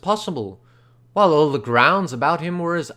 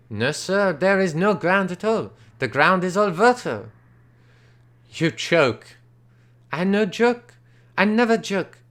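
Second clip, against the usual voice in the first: put-on higher, indignant Frenchman with a French accent; 5 semitones higher than usual. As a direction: insist hotly in French-accented English. Angry Frenchman insists.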